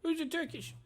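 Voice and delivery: very high, puzzled squeak; confused goblin. Acted. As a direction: squeak a baffled question.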